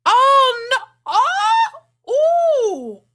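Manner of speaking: loud, very high wails of disbelief; drawn-out high oohs; shocked outcry. Real, not acted. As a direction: cry out as if scandalized. Shock at guest's age.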